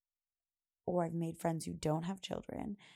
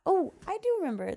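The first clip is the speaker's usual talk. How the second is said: sudden recollection; 12 semitones higher, 8 dB louder than usual. Real, not acted. Sudden recall mid-story.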